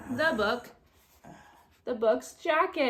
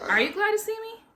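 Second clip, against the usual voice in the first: high, loud hopeful question; eager Amelia; 13 semitones higher, 7 dB louder than usual. Acted. Amelia asking the librarian eagerly, high and loud. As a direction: ask with bouncing delight.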